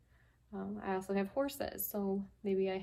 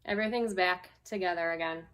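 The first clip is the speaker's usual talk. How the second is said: gentle, unhurried picture-book reading; lightly voiced storytime read; reading to children. Teacher reading a picture book to her class, storytime voice.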